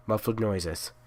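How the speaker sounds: ordinary delivery, nothing marked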